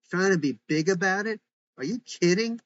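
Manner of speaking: high, loud, outraged disbelief; Samantha losing it. Acted. Teen daughter throws her mom's words back, loud and indignant. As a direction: shriek it back, incredulous and furious. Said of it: "losing it".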